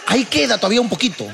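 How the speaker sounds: high-pitched, hopeful insistence; pleading optimism, played for laughs; mock hopeful. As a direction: act out someone finding one last bit, voice up high. Acts out squeezing the toothpaste tube.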